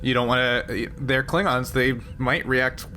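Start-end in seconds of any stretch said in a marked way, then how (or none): none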